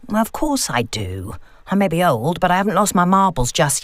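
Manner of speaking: a wry mother, mildly put out; dry elderly mum. Acted. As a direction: tease like a sharp old mum. Mum answers on phone.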